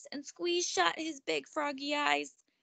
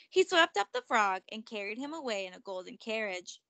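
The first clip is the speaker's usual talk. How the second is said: plain reading voice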